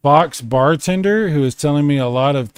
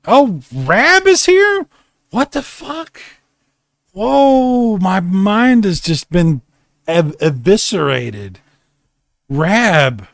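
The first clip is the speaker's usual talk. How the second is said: much higher, stunned delight; excited surprise; 5 semitones higher, slower than usual. Real, not acted. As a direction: gasp at a friend showing up. Thrilled friend arrives.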